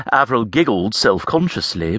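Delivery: ordinary delivery, nothing marked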